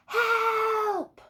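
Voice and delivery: high, loud cry for help; both calling the rescue boat; loudest shout. Acted. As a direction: yell for rescue across the sea. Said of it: "Arthur shouted his very loudest".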